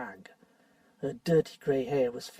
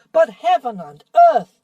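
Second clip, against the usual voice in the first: loud, higher exclamation; a dwarf's indignant cry; outburst; 8 semitones higher, 14 dB louder than usual. Acted. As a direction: fling it out in outrage. Nickabrick exclaims in outrage.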